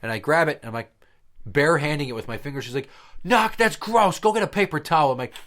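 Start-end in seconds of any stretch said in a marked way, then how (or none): 3.2-5.1 high, loud scolding mom; shrieky scold from mom; disgusted mom. Retold or imitated. Mom scolding her son for grabbing poop, high and loud.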